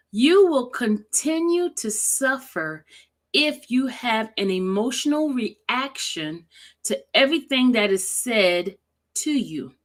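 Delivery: slow, deliberate read with pauses between phrases. Shown as a demonstration. Rereading a quote so viewers can feel it, slowly. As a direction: read it slowly to let the feeling land. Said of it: "I'm gonna read it slowly".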